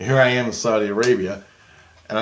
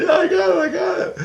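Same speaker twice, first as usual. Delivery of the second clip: ecstatic scream. Retold or imitated. Jesus admiring the car, screaming with delight. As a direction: squeal over the car like a fan. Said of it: "he's screaming".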